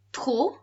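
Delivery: "trop" alone, ending in an open o with no P; silent P. Shown as a demonstration. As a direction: lift trop and leave the P unsaid. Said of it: "'cause the P is on the end, it's silent".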